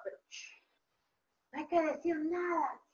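whispered shushing; conspiratorial hush. Pretend. Teacher keeps Ricitos's secret with the children, hushed. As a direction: hush them as if sharing a secret.

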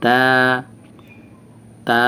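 "তা" with tongue tip on the gum behind upper teeth; teacher's slow, long "তা"; demonstrated articulation. Shown as a demonstration. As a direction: tap the inner gum, then draw "তা" out long. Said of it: "ঠিক ওই মাড়ির ওইখানে জিহ্বার আগাটা লাগবে".

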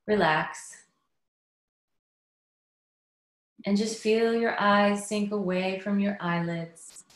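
unhurried meditation guidance with long pauses; guided meditation. Acted. Inviting listeners to settle in before the talk, guided meditation. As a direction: ease them inward, leaving space after each phrase.

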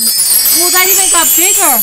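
loud, high-pitched astonishment; amazed exclamation. Real, not acted. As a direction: exclaim in big, rising wonder. Parent amazed at bigger plants.

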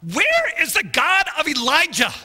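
high, loud pleading cry; crying out to God. Retold or imitated. Preacher retelling his prayer, crying out. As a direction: call out to God in desperation. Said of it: "I'm just crying out to God".

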